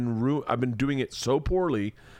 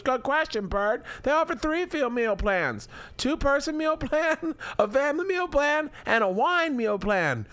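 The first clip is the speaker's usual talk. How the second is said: much higher, sing-song wife voice; wife Leanne voice; 14 semitones higher than usual. Acted. Husband voices wife Leanne pitching Blue Apron, high sing-song. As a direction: play the chirpy wife selling the meal kit. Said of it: "Do you like this character thing I'm doing?".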